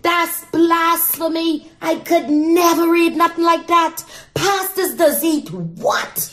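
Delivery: high, slow Bahamian church lady; mock-scandalized Bahamian auntie; Bahamian accent. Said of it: "In my Bahamian accent".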